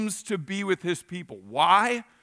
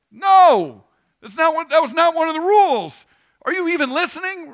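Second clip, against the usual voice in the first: high, loud exasperated rebuke; God yelling at disobedient people, not listening; angry God; 10 semitones higher, 8 dB louder than usual. Acted. God scolding people over golden calf.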